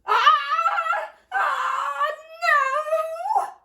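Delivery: very high, loud shrieks; shrieks of panic. Acted. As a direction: scream as if the work is lost. Family shrieking at crash.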